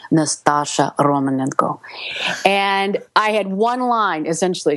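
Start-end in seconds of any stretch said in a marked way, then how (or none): 0.1-1.8 Russian-accented character name; Russian pronunciation of the name. Shown as a demonstration. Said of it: "key to my whole Russian accent".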